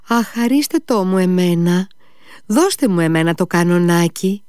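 put-on high childlike begging; high, pleading little-girl voice. A narrator's reading. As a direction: beg for the toy like a small child. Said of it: "άρχισε ξάφνου να παρακαλάει, σαν μικρή".

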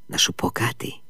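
hushed confiding whisper. Acted. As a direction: lean in and breathe it. Mitya confiding to Fenya.

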